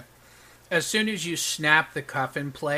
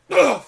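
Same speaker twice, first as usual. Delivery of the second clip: sharp winded grunt as he lands; knocked-down sheriff grunts hitting the ground; pained grunt; 12 semitones higher, 11 dB louder than usual. Acted. Sheriff slams into the ground, pained grunt.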